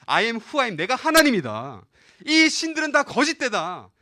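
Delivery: loud, high proclamation with drawn-out endings; God's declaration. Retold or imitated. God to Egypt and Israel about the false gods, loud proclamation. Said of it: "그러면서".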